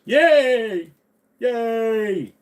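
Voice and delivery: high mock cheer; squeaky cheer. Cheering in a put-on voice.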